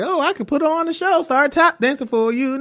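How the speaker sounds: high, bouncy show-off voice; a hammed-up giddy high; mock giddy. Retelling her drug high, mock giddy and high.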